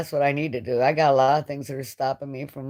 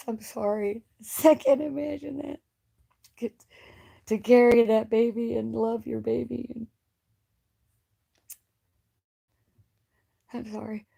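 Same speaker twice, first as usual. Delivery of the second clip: tearful apology; 6 semitones higher than usual. Real, not acted. Caller apologizes for grieving mother, real tears. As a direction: voice breaking up high, then fading out. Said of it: "I'm very emotional when it comes".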